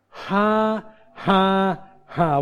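three separate, spoken laugh syllables; deliberate "ha". Shown as a demonstration. Preacher showing congregation how to begin laughing, deliberate ha-ha-ha. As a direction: place each "ha" slowly, stepping down in pitch. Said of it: "as simple as".

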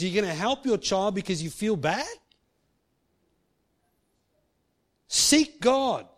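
fired-up preaching. Acted. Rising to full preaching.

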